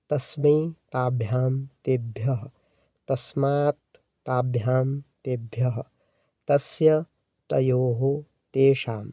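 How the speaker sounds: slow, measured declension chant with drawn-out vowels in "सः तौ ते"; pronunciation demo. Shown as a demonstration. Teacher showing students how the तद forms are pronounced, slow, measured recitation. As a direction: recite the Sanskrit forms slowly, from "सः तौ ते" to "तयोः तेषु", each word separate. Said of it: "इसका उच्चारण कुछ इस प्रकार से होता है".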